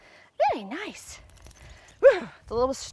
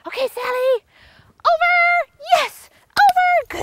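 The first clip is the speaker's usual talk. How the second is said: high, bright commands, a bit louder on over; high jump cues; 5 dB louder than usual. Real, not acted. Trainer calling Sally over the jumps in a high voice. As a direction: sing the cues up high for her.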